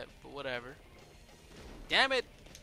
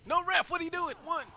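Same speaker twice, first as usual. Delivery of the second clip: excited yelling; 13 semitones higher, 4 dB louder than usual. Real, not acted. Cheering his wrestler on.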